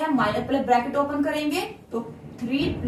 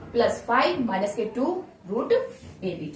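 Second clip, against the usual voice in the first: very slow, word by word, spelling it out for the class; slow teacher dictation. Shown as a demonstration. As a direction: dictate each word slowly so students can write. Teacher dictating slowly to students.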